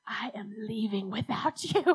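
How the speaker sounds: tense whisper, no voice at all; whispered threat. Retold or imitated. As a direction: hiss the threat under your breath. Tearful threat to her mom.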